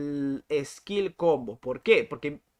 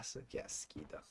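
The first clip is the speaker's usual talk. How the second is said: disgusted whisper; 13 semitones lower, 19 dB quieter than usual. Real, not acted. Streamer whispering disgust at the laggy server. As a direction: breathe "qué asquito" as a soft, voiceless whisper.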